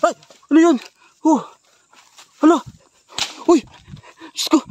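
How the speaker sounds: loud, high startled yell; frightened yell. Real, not acted. Gamer jumping at a scare, high frightened yell. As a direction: yelp it out in shock. Jump scare in horror game.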